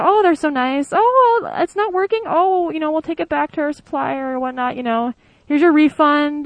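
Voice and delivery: high, sugary customer-service voice, slowing on the refund; overly nice clerk. Retold or imitated. Mocking an American clerk for a German listener, high and sugary. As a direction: gush like a shop clerk eager to please.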